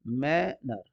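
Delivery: Manner split as मै, then नर; two separate syllables. Shown as a demonstration. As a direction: break manner in two with a small gap. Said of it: "दो syllable".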